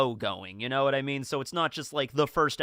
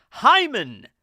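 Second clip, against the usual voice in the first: sudden high, loud blurt; random shouting out; 8 semitones higher, 10 dB louder than usual. Acted. Insulted person answers with a body part, shouting out. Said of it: "randomly shouting out body parts".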